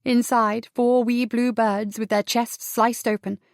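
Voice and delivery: usual speaking voice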